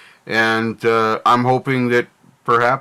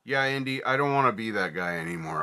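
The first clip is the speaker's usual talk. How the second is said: much softer, weary and resigned; pained admission; 10 dB quieter than usual. Real, not acted. Vlogger tells viewers he no longer wants to be that guy, soft and weary. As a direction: say it like it costs you.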